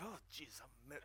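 very low, barely audible mock grumble; mad muttering. Annoyed young man hiding anger as prayer, low muttered grumble. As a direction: mutter it under your breath. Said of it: "I was so mad".

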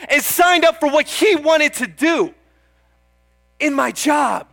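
fervent preacher, slowing on "I laid my life down"; preaching at full force. Acted. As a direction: drive it home to the congregation. Preaching climax, rising intensity.

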